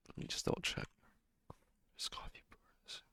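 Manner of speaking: quiet unvoiced whisper to self. Real, not acted. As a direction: whisper it under your breath.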